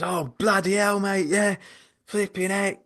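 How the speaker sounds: high-pitched worked-up venting; acted exasperation, pitched right up; retold exasperation. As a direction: imitate a rattled mate venting. Retold exasperated friend on phone.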